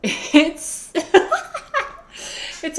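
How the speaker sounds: high giggle bursting out; laughing. Real, not acted. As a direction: laugh at how crazy it is. Laughs at own project.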